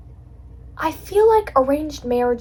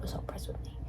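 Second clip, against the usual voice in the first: whispering; 17 dB quieter, faster than usual. Real, not acted. Gushing about dad's pride.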